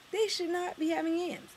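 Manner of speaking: high, emphatic protest; indignant exclamation. Real, not acted. Vlogger complaining about soul food, high indignant protest.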